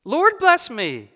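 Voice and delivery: high, loud, drawn-out exclamation; astounded gentleman, pitched high; astonished cry. A narrator's reading. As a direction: cry out slowly in loud amazement. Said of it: "cried the gentleman, as if his breath were gone".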